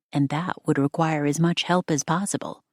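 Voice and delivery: plain reading voice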